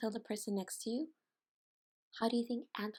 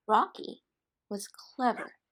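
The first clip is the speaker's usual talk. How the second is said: usual speaking voice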